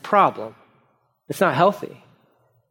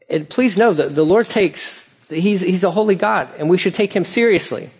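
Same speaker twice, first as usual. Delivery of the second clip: ordinary talk, nothing marked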